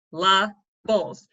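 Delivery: slow, drawn-out "syllables" split into its beats; syllables broken apart. Shown as a demonstration. Teacher sounding out a word for students, slow and broken. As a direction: sound out "syllables" beat by beat, slowly. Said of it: "You stop kind of when you're saying it".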